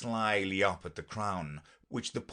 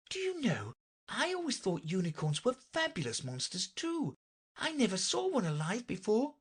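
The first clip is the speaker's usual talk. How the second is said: put-on high-pitched girlish character voice; Alice, far higher than the narration; young Alice; 13 semitones higher than usual. Alice chatting to the unicorn, high and girlish.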